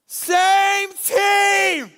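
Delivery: high, loud shouted call; sideline yell. Retold or imitated. Teammate on the sideline yelling at scrambling players, loud shout. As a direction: bellow it across the court. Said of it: "somebody on the sidelines yelled out".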